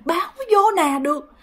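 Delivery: high, plaintive spirit voice; possessed grandfather. Retold or imitated. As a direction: imitate a hungry, beaten father lamenting. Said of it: "ông nói rằng". (giọng hồn ông than thở, cao)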